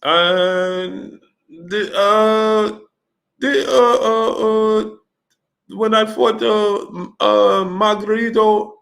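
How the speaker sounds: put-on high-pitched, halting accented voice full of drawn-out uhs; Manny impression. Boxer explaining he gave the title away, stumbling Manny impression. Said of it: "He said".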